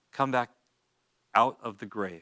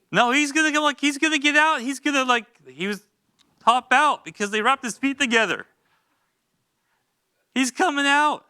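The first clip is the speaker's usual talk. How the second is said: much higher, louder, eager telling; bursting with excitement; excited; 11 semitones higher, 3 dB louder than usual. Real, not acted. Excited retelling of Lazarus emerging.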